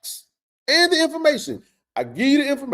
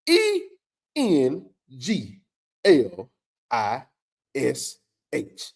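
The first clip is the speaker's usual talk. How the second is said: each letter spelled out slowly, with pauses; deliberate spelling; 5 semitones lower, much slower than usual. Shown as a demonstration. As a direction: spell it out one letter at a time. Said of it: "Plain English said".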